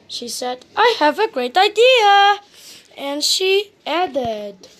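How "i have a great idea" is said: high, eager storybook exclamation; gleeful catchphrase delivery; excited announcement. A narrator's reading. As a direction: announce the bright idea, pitched up. Heroine's catchphrase, the punchline setup.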